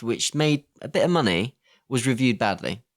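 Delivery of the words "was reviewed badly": usual speaking voice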